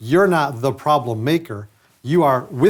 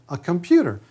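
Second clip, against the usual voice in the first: incredulous question climbing higher; imitated amazement; 8 semitones higher than usual. Dentist imitating a patient hearing about the device, amazed. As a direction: mimic a wide-eyed patient, pitch rising. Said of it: "always met with a profound".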